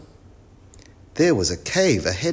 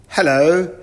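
loud, high professor's hello; eccentric professor; 10 semitones higher, 9 dB louder than usual. Acted. Professor Tangle greets the children, loud and high. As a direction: greet them loudly, pitched well up.

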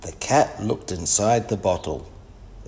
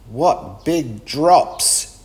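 higher, louder, very drawn out; amazed exclamation; 5 semitones higher, 5 dB louder, much slower than usual. A narrator's reading. Wilma sees huge raindrops falling, amazed exclamation. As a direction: marvel slowly over each word.

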